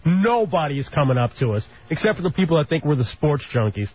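ordinary talk

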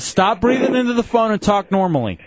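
exasperated order. Real, not acted. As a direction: order the caller firmly, fed up. Host scolding a panting caller.

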